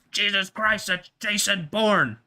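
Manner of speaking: high, loud, drawn-out film line; mock alarmed exclamation. Comic quoting a spy movie, mock alarmed exclamation.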